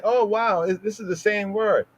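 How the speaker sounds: imitating a naive reader; an exaggerated wow, as if fooled; high, breathy-free mock surprise at a lookalike word; mock amazement. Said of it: "No, it's similar".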